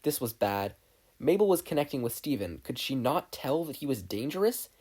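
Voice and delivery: plain reading voice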